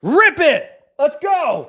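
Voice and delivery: high, loud hyped cheer; excited. Real, not acted. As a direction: cheer it like a win. Hype over a good offer.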